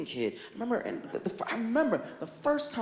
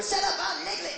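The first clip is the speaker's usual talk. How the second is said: cartoon character retort; 11 semitones higher than usual. Imagined character snapping back.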